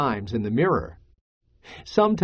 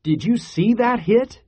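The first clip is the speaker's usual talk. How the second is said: higher-pitched, eager question; excited question; 8 semitones higher than usual. Acted. Miguel to his sister after the game, excited question. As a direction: ask it with a fan's excitement.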